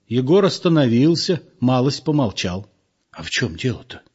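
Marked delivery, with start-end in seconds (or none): 3.1-4.0 soft, lower, offended whisper; hurt whisper. Acted. Egor whispers back at Lyuba, hurt. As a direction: whisper it, sulking and wounded. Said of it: "спросил он обиженно шёпотом".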